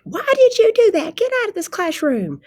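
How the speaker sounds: loud, high-pitched angry teacher scolding; mock screaming. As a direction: yell it like a teacher losing her temper. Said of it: "I don't wanna be screamed at".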